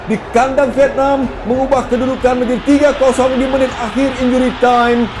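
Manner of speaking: high excited play-by-play; goal call. Real, not acted. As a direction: keep the pitch up, celebrating. Third goal commentary.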